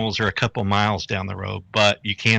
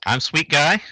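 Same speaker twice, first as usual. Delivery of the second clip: higher playful; 6 semitones higher than usual. Real, not acted. Corrected name swap, playful.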